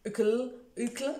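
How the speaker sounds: "Hucle, Hucle" tried out slowly, two hesitant attempts; struggling pronunciation. Shown as a demonstration. Vlogger testing an unpronounceable character name, slow uncertain attempts at "Hucle". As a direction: sound out "Hucle" slowly, unsure how it goes. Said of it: "je n'arrive pas à le prononcer".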